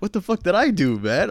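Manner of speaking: confused self. Retold or imitated. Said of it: "And I was like".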